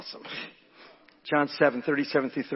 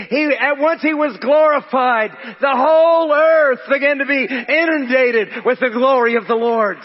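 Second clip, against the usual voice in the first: raised, emphatic pulpit voice; forceful preaching; 8 semitones higher, 7 dB louder than usual. Real, not acted. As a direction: preach it out to the congregation.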